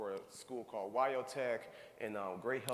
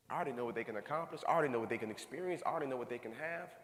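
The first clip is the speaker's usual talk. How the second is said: rapid-fire build; much faster than usual. Real, not acted. Speaker builds fast repetition.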